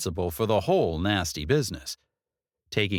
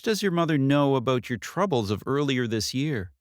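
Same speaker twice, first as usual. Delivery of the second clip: a lightly voiced detective, probing question; 6 semitones higher than usual. Detective probing Katie about her mother, lightly voiced. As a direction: ask it as a careful investigator.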